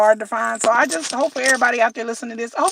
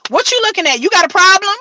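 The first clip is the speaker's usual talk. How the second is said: husband confronting; 7 semitones higher, 4 dB louder than usual. Retold or imitated. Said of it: "he'd be like".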